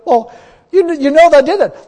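loud, high insistent protest; indignant protest. Retold or imitated. Man protesting his sin to God, loud and high. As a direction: insist on it, voice climbing.

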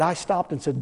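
ordinary talk, nothing marked

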